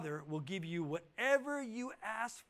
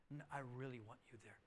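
much softer, quick and hushed; gentle insistence; 7 semitones lower, 13 dB quieter, faster than usual. Acted. The king to the humble villager, hushed insistence. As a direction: coax him in quietly.

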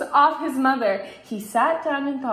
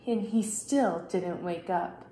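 ordinary delivery

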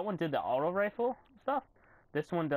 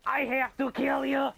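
slow, higher, loud yell of rage; furious shout; 10 semitones higher, 5 dB louder than usual. Real, not acted. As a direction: roar it at the enemy. Raging at enemy in game.

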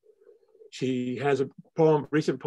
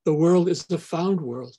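measured poem recital with long pauses; a poet closing her reading; poetry reading; 3 semitones higher than usual. Acted. Poet reads her poem aloud.

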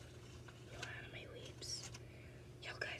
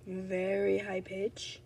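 slowed down; 15 dB louder, much slower than usual. Real, not acted. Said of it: "You can tell I'm sick because my voice is".

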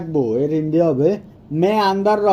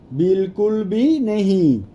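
stretched words, spaced for learners; teacher's slow demonstration; slow model repeat; slower than usual. Shown as a demonstration. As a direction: say it slowly, word by word.

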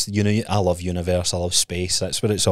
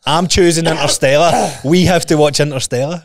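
mock bossy passenger; 7 semitones higher, 6 dB louder than usual. Mocking pushy film chooser.

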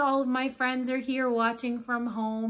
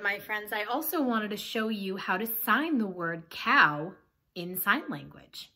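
usual speaking voice

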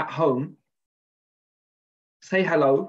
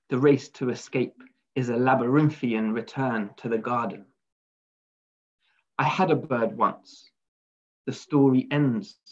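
poem reading. Acted. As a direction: read it as verse, line by line, letting each phrase settle. Reads a poem aloud.

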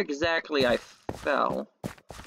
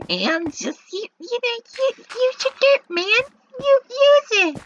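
much higher, squeaky cartoon voice; silly joke voice; 5 dB louder than usual. Gamer telling himself to use his dirt, in a squeaky silly voice. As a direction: chant the catchphrase in a squeaky goofy voice.

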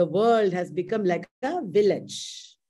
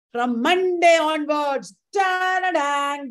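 excited announcement; 5 semitones higher than usual. Real, not acted. Teacher announcing next week's classes.

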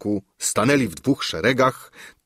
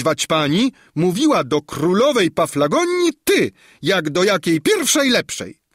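much higher, louder sneering dowager; scornful old countess; 11 semitones higher, 4 dB louder than usual. A narrator's reading. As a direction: scold the fairy from on high. Countess rebuffs the fairy. (wyniosła, szydercza hrabina, wysoko i głośno)

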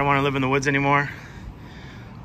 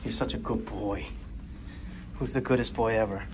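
quieter, gentle praise voice; soft dog praise; 2 semitones lower, 9 dB quieter than usual. Real, not acted.

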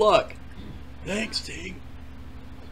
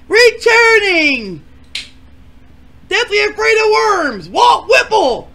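high, loud game show announcer shout; announcer intro; 11 semitones higher, 7 dB louder, slower than usual. Acted. Host introducing a returning contestant, loud announcer shout. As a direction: boom out the contestant's name.

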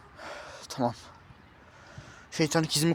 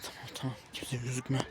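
much softer, low self-warning; hushed to self; 4 semitones lower, 14 dB quieter than usual. Acted. Hiding, softly warning himself not to be seen. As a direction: hush yourself so nobody hears.

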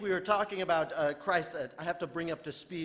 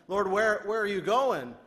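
raised, louder voice as Peter asking Jesus; 4 semitones higher, 7 dB louder, slower than usual. Retold or imitated. Peter asks Jesus where he goes, raised anxious voice. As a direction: call out like an anxious disciple.

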